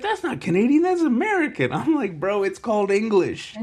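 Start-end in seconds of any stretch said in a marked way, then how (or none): 0.0-1.7 high, loud mock objection; protesting schoolmate. Schoolmate objecting to the Canadian demo, high loud mock protest. 2.2-3.6 high-pitched mock exasperation; exasperated comeback. New boy correcting his schoolmate, high exasperated retort.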